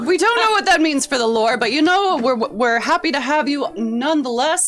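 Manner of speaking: high, loud, fast host chatter; bubbly centaur host. Acted. Centaur host reassuring the chef, high and loud. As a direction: keep the showman cheer going.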